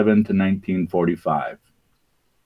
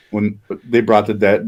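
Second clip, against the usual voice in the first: usual speaking voice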